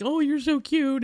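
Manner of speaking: high-pitched cooing at a dog; loving gushing. Retold or imitated. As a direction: imitate a clown doting on a puppy. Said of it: "just loving the dog".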